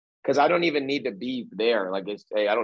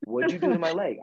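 excited question; 6 semitones higher than usual. Retold or imitated. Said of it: "He's all excited".

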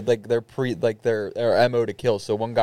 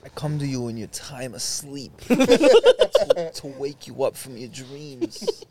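mobster ghost; much slower than usual. Acted. Ghost to the sleeping man, slow mob boss. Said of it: "one of the ghosts".

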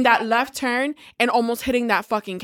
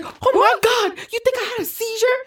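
panicked; 11 semitones higher than usual. Retold or imitated. Panicked reply to the nurse.

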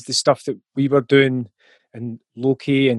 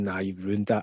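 pal's dismissive verdict; 6 semitones lower, 10 dB quieter than usual. Retold or imitated. Bandmate dismissing the recording, low and laughing. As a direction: lower and much softer, laughing through it. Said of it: "my pal that we were playing the band with going".

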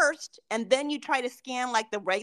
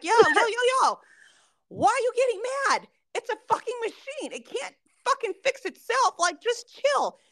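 high-pitched coworker impression, urging calm; Sergio; 9 semitones higher, 3 dB louder than usual. Coworker tells an angry colleague to chill, high-pitched. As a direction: put on a much higher, incredulous voice. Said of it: "Sergio's like".

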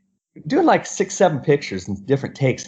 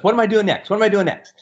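put-on higher-pitched quote of an eager kid; lightly acted eagerness, pitched up; imitated eager kid; 7 semitones higher, faster than usual. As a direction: mimic a kid keen for more.

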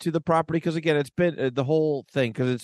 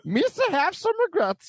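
very high goofy alien voice; a silly Gungan apologizing, very high; Jar Jar voice. Acted. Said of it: "Jar Jar".

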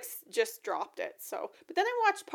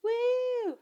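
excited cheer; 13 semitones higher than usual. Real, not acted. Cheering the co-host intro.

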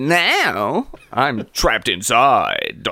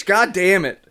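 ordinary delivery

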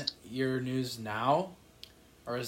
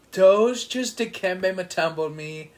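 mock outrage; 5 semitones higher, 8 dB louder, slower than usual. Real, not acted. Mock outrage at being blocked.

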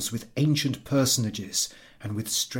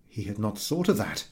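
plain reading voice

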